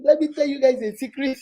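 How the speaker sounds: ordinary talk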